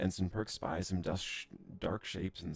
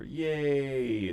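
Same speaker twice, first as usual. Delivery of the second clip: higher, louder, stretched-out yay; excited cheer; 7 semitones higher, 7 dB louder than usual. Real, not acted. Player cheering on finding copper, stretched and loud. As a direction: celebrate the find.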